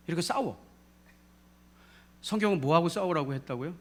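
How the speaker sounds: ordinary delivery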